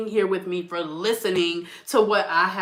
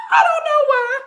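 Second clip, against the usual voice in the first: repeated while laughing, much higher; 4 dB louder than usual. Real, not acted. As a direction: laugh right through the words.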